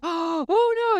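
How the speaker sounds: sharp gasp, then a high, alarmed "oh no"; mock panic at a toddler's fall. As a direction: gasp as if the child is in real danger. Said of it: "you fell into a bottomless pit and you're gonna die".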